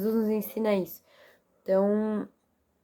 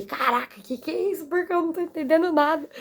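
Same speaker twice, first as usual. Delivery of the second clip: amazed disbelief; 7 semitones higher than usual. Retold or imitated. Retold shock at sudden compassion.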